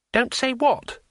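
high-pitched baffled question; high, bewildered character voice; puzzled. Acted. Puzzled reply to a warning.

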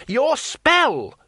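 high, loud urgent shout; high-pitched shout of alarm. A narrator's reading. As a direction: yell a desperate reminder. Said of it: "shouted Two Flower".

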